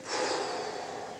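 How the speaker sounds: deep audible exhale; sigh of relief. Shown as a demonstration. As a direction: let out a long sigh. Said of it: "what's the last thing they do".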